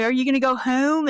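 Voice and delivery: goofy mock Delco accent. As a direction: exaggerate the Delco O in "home".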